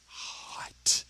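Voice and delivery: slow drawn-out whisper. Real, not acted. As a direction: whisper it slowly. Complains about the heat.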